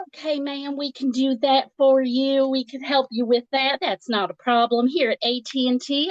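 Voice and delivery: high, chirpy Southern customer service voice; happy Southern accent. Retold or imitated. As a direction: imitate a very happy Southern phone rep. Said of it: "literally said that in that accent ... very Southern and very happy".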